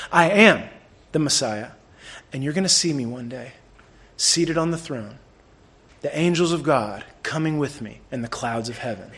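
low, soft, measured pronouncement with long pauses; quiet solemn declaration. Retold or imitated. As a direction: answer with hushed certainty, phrase by phrase. Jesus answering calmly amid torture.

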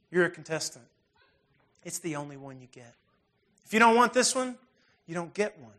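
ordinary delivery

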